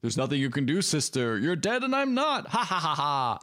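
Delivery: hammed-up dark sorcerer reciting his plan; villainous uncle. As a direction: intone a hammy prophecy, ending in a staged evil laugh. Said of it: "cut to the uncle who's like".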